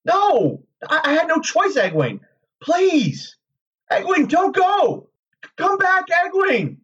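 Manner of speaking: very high, anguished begging; desperate pleading. A narrator's reading. Rand calling after departing Egwene, desperate. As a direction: cry out for her to come back.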